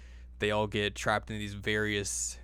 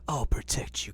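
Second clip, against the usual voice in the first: whispered vow; 3 semitones higher, 3 dB quieter than usual. Acted. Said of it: "he's like".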